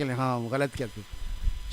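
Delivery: a hint of a chuckling elder; good-natured slow confession; smiling admission. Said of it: "تو مسکراتے کہ".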